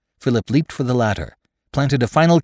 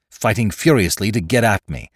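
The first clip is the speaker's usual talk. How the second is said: ordinary delivery, nothing marked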